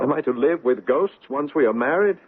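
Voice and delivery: anguished outburst. Acted. Son to his father about his haunted bride, anguished and pleading. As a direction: throw the question at him in despair.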